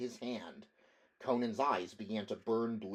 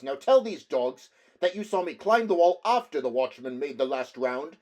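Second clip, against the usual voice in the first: higher, louder angry accusing voice; furious accusation; 6 semitones higher, 12 dB louder than usual. Acted. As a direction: accuse him hotly. Barbarian angrily accuses nobleman.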